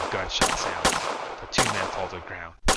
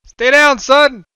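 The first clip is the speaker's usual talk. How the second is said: loud, much higher order; shout; 18 dB louder, much slower than usual. A narrator's reading. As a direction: yell the son to safety. Father shouting back.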